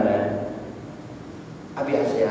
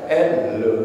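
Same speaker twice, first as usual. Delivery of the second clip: rude intonation demo. Shown as a demonstration. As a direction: address an elder too casually. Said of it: "ini intonasi nih". (contoh intonasi kurang sopan)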